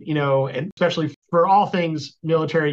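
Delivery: normal talking voice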